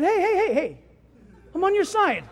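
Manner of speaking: high, loud hey-hey-hey; urgent protest. Acted. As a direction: fend off an attacker while insisting you're a friend. Acted protest to the angry sparrow.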